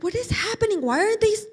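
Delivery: put-on bewildered kid about to cry; upset whine. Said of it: "And Eric's like".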